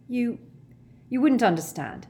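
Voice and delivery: sighing reluctance. A narrator's reading. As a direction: give up on explaining, with a sigh. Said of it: "Ron sighed again".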